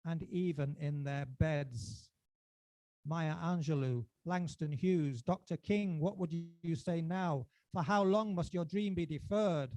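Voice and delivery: rising and falling spoken-word recital, charged; performance poetry. Acted. Poet reciting his poem to a live crowd, dramatic. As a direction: declaim each line to the audience like a manifesto.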